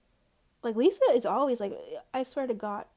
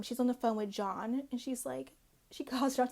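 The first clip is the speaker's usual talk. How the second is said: ordinary talk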